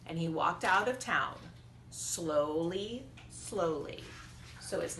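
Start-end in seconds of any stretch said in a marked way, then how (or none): none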